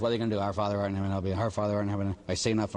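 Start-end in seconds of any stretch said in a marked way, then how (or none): 0.4-2.1 low, mumbled run-through of a prayer; rushed rote prayer. Retold or imitated. Penitent doing ten Our Fathers as penance, rushed and rote. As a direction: race through the words without meaning them. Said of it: "they just say these prayers really, really fast".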